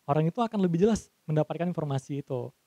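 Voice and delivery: usual speaking voice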